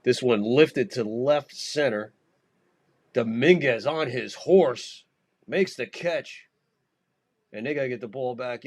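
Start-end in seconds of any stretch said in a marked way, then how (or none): 0.0-6.5 slow, louder drawn-out play-by-play; play-by-play call. Acted. Host calling Dominguez's catch live, drawn-out play-by-play. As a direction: call the fly ball as it hangs.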